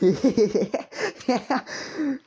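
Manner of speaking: high, loud surprised laugh; sudden burst of laughter. Real, not acted. Caught off guard by his own joke, bursting out laughing. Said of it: "why I'm laughing to it".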